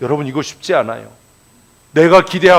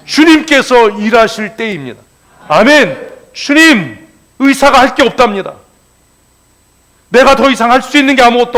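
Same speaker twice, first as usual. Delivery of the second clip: loud, raised fervent preaching; full-force preaching; 6 semitones higher, 8 dB louder than usual. Acted. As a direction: drive it home to the congregation. (힘찬 설교조)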